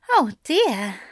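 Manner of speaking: lightly voiced fussy angel. Aziraphale voice.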